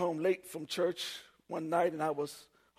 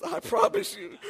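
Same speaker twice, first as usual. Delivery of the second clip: laughing; 6 dB louder than usual. Retold or imitated. Laughing mid-promise to Lord.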